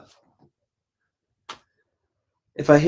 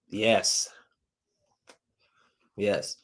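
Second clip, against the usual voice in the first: ordinary delivery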